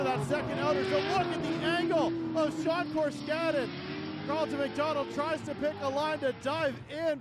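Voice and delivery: fast, rising hype call over the action; play-by-play commentary. Acted. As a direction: call the battle live with energy. Live drift commentary.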